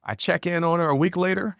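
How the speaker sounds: ordinary talk, nothing marked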